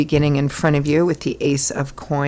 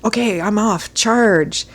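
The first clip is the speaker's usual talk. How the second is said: eager, higher knight charging off; 6 semitones higher, slower than usual. Retold or imitated. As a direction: dash off keen for the quest. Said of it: "and he's like".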